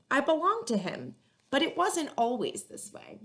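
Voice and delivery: put-on lively read-aloud to children, rhymes bouncing; story narration. A narrator's reading. Librarian reading a picture book to kids, lively and rhythmic. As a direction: storytime voice for young listeners.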